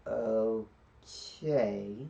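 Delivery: long, slow stretched-out reply; drawn-out okay. Retold or imitated. Genie answering Aladdin after hearing the rules, very drawn out. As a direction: stretch both syllables right out.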